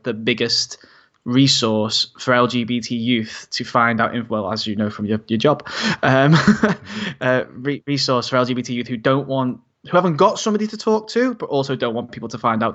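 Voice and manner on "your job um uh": ordinary talk, nothing marked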